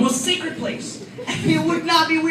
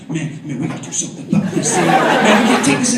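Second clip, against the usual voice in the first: much lower, fast mock-tough voice; brother's street talk; 9 semitones lower than usual. Brother rallying his sibling against a teasing cousin, mock-tough. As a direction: act the tough little brother. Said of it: "he's just like".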